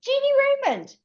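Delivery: high, surprised call of her name; a sudden high greeting from the road. Retold or imitated. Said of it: "he said".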